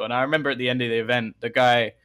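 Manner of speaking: usual speaking voice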